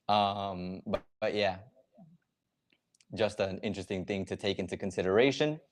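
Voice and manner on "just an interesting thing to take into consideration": ordinary talk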